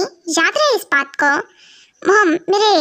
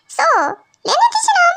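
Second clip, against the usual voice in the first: sweet plea; 12 semitones higher than usual. Acted. Husband pleads to mother.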